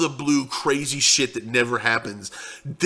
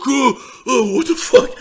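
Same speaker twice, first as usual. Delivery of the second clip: high, loud, frightened cry; scared; 9 semitones higher, 4 dB louder, slower than usual. Retold or imitated. Friends watching the chaos unfold, a scared high cry. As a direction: squeal it like you're terrified. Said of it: "scared shitless".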